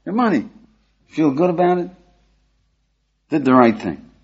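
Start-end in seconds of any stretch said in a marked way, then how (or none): 0.1-0.5 calling after him. Retold or imitated. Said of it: "Run after him and you say".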